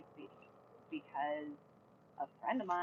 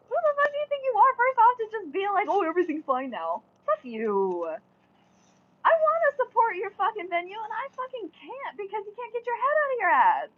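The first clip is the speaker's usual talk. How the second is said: much higher and louder, fast, venting real outrage; furious rant; 13 semitones higher, 9 dB louder than usual. Real, not acted. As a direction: tear into the venue at full volume. Real rant about the venue.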